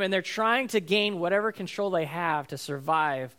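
usual speaking voice

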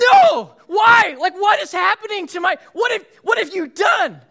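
high, loud hammed-up outrage at the bitten sandwich; mock furious shout. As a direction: yell it like a comic meltdown over a lost bite. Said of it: "And I was furious".

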